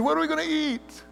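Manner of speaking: put-on high, whiny childish wail; whiny children. Hungry Israelites griping in the desert, high childish whine. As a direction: whine for food like a hungry kid. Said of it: "they sounded often like children".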